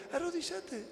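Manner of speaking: high, hushed order; quiet high-pitched acted command; soft insistent command. God's command repeated softly.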